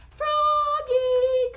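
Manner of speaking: high, loud calling out; called. Acted. Mother calling Froggy to get up, loud high call. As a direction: call him from downstairs. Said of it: "called his mother".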